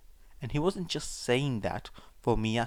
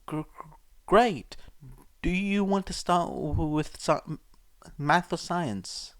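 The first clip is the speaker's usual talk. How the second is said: stammering, higher, nervous brightness; forced cheer; 6 semitones higher, 3 dB louder than usual. A narrator's reading. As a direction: smile through the fear. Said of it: "trying to smile despite the man glaring".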